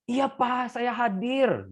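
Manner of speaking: the son reporting for duty with gusto; the eldest son, full of spirit; eager answer. Acted. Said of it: "menjawab dengan semangat".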